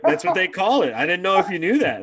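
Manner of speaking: animated, emphatic disbelief; excited insistence. Real, not acted. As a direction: push the point eagerly.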